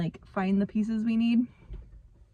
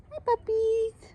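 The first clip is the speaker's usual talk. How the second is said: high, slow sing-song puppy voice; slower than usual. Real, not acted. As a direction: coo at the puppies. Greeting the puppies.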